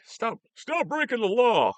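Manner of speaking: high, drawn-out comic yell; mock yell. Exasperated reply to people breaking law, mock yelling. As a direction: build to a slow, high-pitched shout. Mock yelled plea to lawbreakers.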